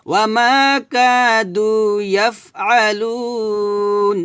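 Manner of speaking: slow chanted recitation with a long final আলুন; Quranic recitation. Acted. Teacher closing with the verse recited. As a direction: recite smoothly, stretch the ending. Recites after correcting pronunciation.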